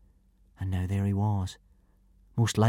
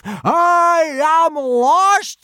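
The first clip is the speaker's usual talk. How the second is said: slow, much higher, louder tirade; furious howl; 6 dB louder, slower than usual. Acted. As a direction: build to a raging howl. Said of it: "howled Gobber".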